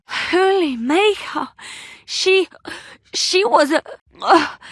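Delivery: slow, high cry of alarm breaking off; a high, stunned exclamation trailing into a groan; shocked gasp. Acted. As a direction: reel in horror, words failing. Shocked after a demon encounter.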